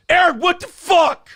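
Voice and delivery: hammed-up angry fan shouting; mock outraged yell. Mocking haters yelling at him.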